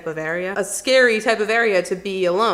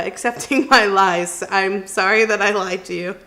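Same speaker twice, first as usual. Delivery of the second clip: ordinary talk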